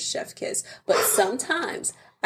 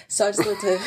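laughing; 5 dB louder than usual. Retold or imitated. Overly sweet wife, breaking.

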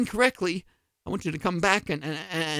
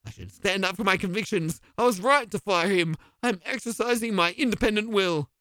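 ordinary delivery

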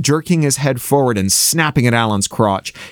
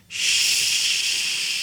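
soft, drawn-out hush; shushing; 7 dB quieter than usual. A narrator's reading. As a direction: shush close to the ear. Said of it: "putting his lips up against Davy's ear".

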